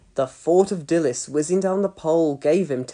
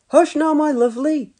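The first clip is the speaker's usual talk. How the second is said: soothing mum; 10 semitones higher, 4 dB louder than usual. A narrator's reading. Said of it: "said Dilys".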